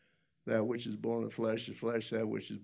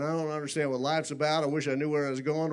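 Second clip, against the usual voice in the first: retold plea, louder and higher; imitated crying out; 4 semitones higher, 6 dB louder than usual. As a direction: voice a farmer's outcry, mildly raised. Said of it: "crying out, just saying".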